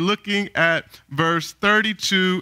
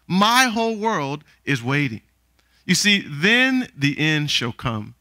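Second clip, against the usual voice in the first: usual speaking voice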